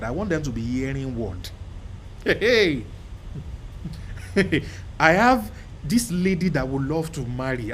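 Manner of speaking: ordinary talk, nothing marked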